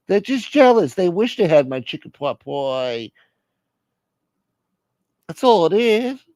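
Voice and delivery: put-on soothing phone voice; placating relative. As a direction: talk an upset parent down.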